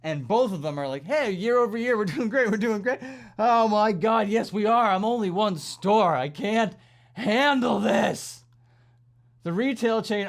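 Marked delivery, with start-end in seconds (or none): 1.0-3.0 higher, louder chirpy corporate voice; mock corporate cheer. Company mocked as bragging about sales, chirpy and loud. 3.4-8.4 louder and higher, at wits' end; overwhelmed store worker cries out, louder and higher; exasperated outburst. Real, not acted.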